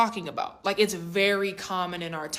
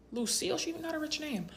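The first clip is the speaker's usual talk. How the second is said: soft, higher-pitched murmur of disbelief; hushed aside; 7 semitones higher, 11 dB quieter, faster than usual. Retold or imitated. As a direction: say it under your breath, impressed. Hushed aside on the wife's name.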